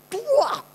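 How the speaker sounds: sharp high spitting sound; mock spit. Boy spits at the rough vaccinator, high spitting sound. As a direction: spit it out at the nurse.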